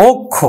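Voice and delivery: অক্ষ as okkho, doubled kh; closed o vowel. Shown as a demonstration. Teacher repeating the corrected word, okkho with closed o. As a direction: model অক্ষ starting on a round o. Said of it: "এর উচ্চারণ হলো কিন্তু".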